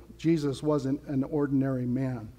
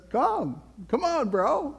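higher, breezy friendly invitation; casual Jesus; 9 semitones higher, slower than usual. Acted. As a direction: wave him over like a buddy. Preacher voices Jesus inviting Peter.

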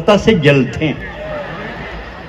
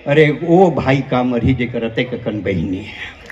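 low, soft aside; softly spoken, low delivery; understated punchline; 7 semitones lower, 7 dB quieter than usual. Real, not acted. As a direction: drop the voice and toss the line away. Punchline, first take thrown away.

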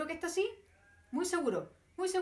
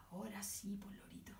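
whisper; 4 semitones lower, 14 dB quieter, faster than usual. Real, not acted. Aside to the puppet.

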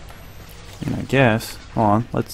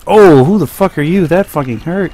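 loud startled outcry, then pained; hit hard crying out; 6 semitones higher, 5 dB louder than usual. Real, not acted. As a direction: cry out as you take a hit. Getting hit in game.

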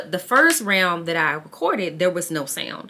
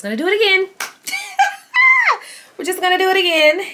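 high, loud, laughing through the frustration; frustrated excited outburst; 12 semitones higher, 6 dB louder, faster than usual. Real, not acted. Vlogger shouting at herself to start over, manic.

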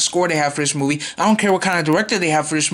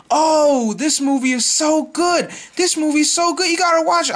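mock-gushing fan voice, pitched higher; 9 semitones higher than usual. Vlogger mimicking fans raving about the reboot, gushing and higher. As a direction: ham up an overexcited moviegoer. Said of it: "I don't care if people say".

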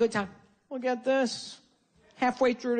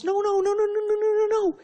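frantic wife; 11 semitones higher than usual. Retold or imitated. Comic as the wife defending her husband, frantic. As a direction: imitate a wife frantically interrupting in a high voice. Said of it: "his wife goes".

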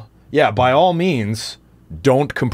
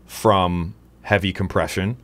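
normal talking voice

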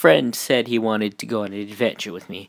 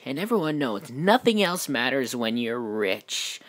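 usual speaking voice

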